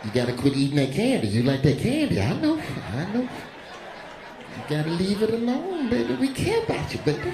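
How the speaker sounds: soothing, sing-song caring nurse; tender nurse. Retold or imitated. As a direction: coax him gently like a child.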